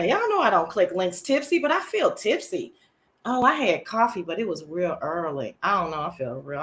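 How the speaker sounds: ordinary talk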